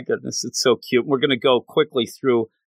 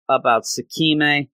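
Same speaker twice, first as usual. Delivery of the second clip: plain reading voice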